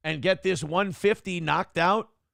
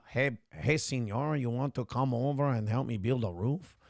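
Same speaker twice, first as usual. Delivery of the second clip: mock Spanish accent; 5 semitones lower, 6 dB quieter than usual. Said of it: "every time we do an accent".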